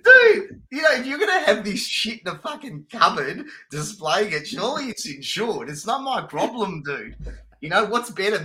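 high-pitched, incredulous self-defence; hammed-up, high-pitched excuse-making; mock protest. Acts out his cheeky self-defence.

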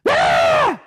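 sudden high scream; high piercing yell; kids' scream. Retold or imitated. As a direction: shriek like a fighting child. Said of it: "And then the next moment".

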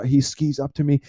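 usual speaking voice

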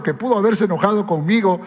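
ordinary delivery

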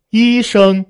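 very high, loud level tones; very high key. Shown as a demonstration. Teacher topping the climb in pitch, very high key. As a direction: push both syllables to the top of the voice. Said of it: "它都是医生".

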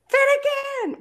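high, loud call of a name; calling out Finnegan. Retold or imitated. Neighbor calling to the misbehaving son, loud high call. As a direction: call the boy from across the yard. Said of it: "my neighbors that can be like".

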